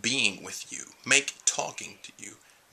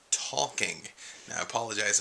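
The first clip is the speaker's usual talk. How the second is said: normal talking voice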